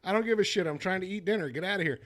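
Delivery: usual speaking voice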